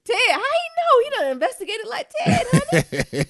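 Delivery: high, loud squeal of amazement; half laughing, half shouting; excited disbelief. Real, not acted.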